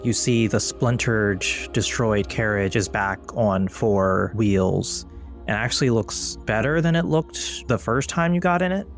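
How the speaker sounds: ordinary delivery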